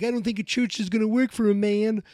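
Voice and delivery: high, put-on dopey objection; Argyle. Confused friend doubting a church will help, high put-on voice. Imitating Argyle's joke in retelling.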